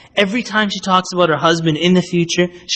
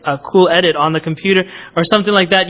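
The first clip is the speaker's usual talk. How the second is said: ordinary delivery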